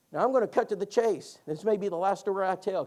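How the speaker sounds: ordinary delivery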